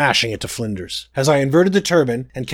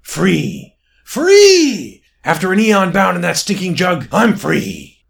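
high, loud demon's cry of freedom; hollow ringing cry; 5 semitones higher, 4 dB louder, slower than usual. Acted. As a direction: exult like a freed spirit. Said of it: "a hollow, ringing voice cried".

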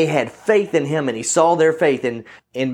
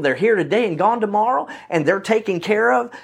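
ordinary talk, nothing marked

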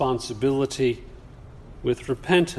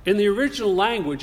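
usual speaking voice

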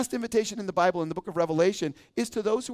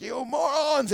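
mock exasperated Moses; 8 semitones higher, slower than usual. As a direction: hurl the insult in play-acted anger. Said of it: "I could just see Moses like".

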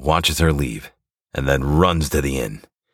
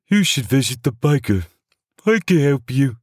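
higher, helpful clerk voice; scribe voice; 12 semitones higher than usual. Acted. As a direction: point the way kindly. Scribe advises hero.

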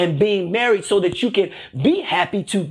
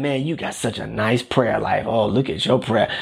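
mock admirer; 8 semitones lower than usual. Imagined churchgoer praising someone's prayer life, mock-admiring in a low voice. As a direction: play a fake fan, pitched well down. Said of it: "No one's gonna be commenting on you and saying".

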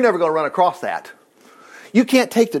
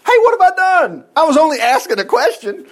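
high, loud put-on kid brother; indignant protest; 10 semitones higher, 4 dB louder than usual. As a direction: protest your innocence, acted out. David protests to his brother.

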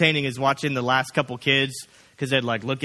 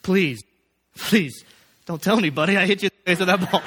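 higher, louder, half-laughing plea; mock pleading; 5 semitones higher, 4 dB louder than usual. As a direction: beg the kid, hammed-up panic. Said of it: "I'm saying".

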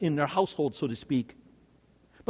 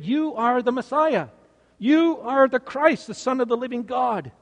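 higher-pitched, ringing confession; raised voice, each phrase stressed; emphatic declaration; 6 semitones higher than usual. Acted. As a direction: proclaim it with conviction. Voicing Peter's confession of faith.

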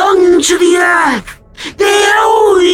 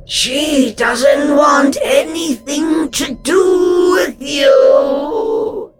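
high, slow, cold ghost voice; without sympathy; much slower than usual. A narrator's reading. Ghost telling Alf his wife wants nothing of him, cold. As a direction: deliver it with no pity. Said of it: "her voice void of any sympathy".

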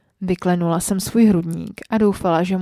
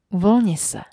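plain reading voice

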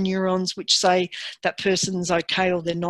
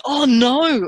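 higher, louder hammed-up oh no with a laugh; mock dismay; 5 semitones higher, 5 dB louder than usual. Conductor's rejected reaction, a hammed-up oh no. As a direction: play a mock disaster reaction. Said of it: "instead of going".

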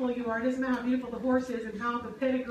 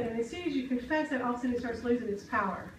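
normal talking voice

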